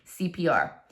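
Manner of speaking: ordinary delivery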